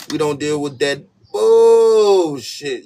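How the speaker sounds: high, loud, very slow stretched refusal; over-the-top loud declaration; exaggerated. Shown as a demonstration. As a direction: ham it up, drag the last word out. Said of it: "You gotta say it with the exaggerations".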